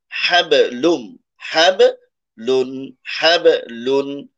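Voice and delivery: হাবলুন stretched slowly, ending on a held n; demo of hablun. Shown as a demonstration. Teacher shows students how the word is read. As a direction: sound out হাবলুন syllable by syllable, slowly. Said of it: "তারপরের শব্দটা দেখুন".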